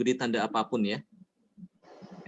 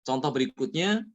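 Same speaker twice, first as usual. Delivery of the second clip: usual speaking voice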